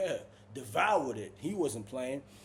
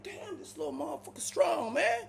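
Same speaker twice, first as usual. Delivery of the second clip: much higher, stunned aside; half-joking shock at his strength; amazed; 14 semitones higher than usual. Retold or imitated. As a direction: voice jumps up in disbelief. Surprised at roommate's strength.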